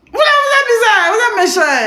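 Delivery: high-pitched pretend pout; mock squeaky scolding. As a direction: tease them like a sulky child. Teasing viewers in a squeaky voice.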